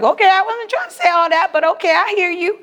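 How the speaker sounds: high, quick, defensive woman; Auntie Sam. Acted. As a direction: back off fast in a high put-on voice. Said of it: "Auntie Sam was like".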